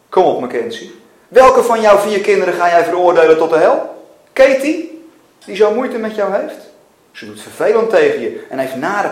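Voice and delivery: loud, insistent prodding; forceful challenge. A narrator's reading. God demanding Mackenzie judge a child, loud and insistent. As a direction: hammer the questions home.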